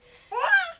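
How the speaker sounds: sudden high squeal of delight. Real, not acted. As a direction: squeal with joy at the gift. Delighted at the plush lobster.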